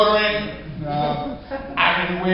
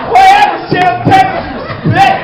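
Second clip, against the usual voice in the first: loud call-out; 8 semitones higher, 8 dB louder than usual. Real, not acted. Mock shout at audience member.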